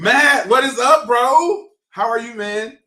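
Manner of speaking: excited greeting. Real, not acted. Greeting a friend joining stream.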